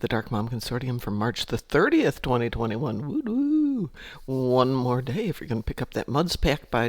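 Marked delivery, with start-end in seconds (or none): 3.1-3.9 playful whoop. Real, not acted. Cheerful exclamation after greeting.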